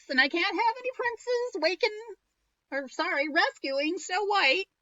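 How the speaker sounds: high, cackly crone voice; a sly old witch offering an apple. Acted. Second witch voiced in story.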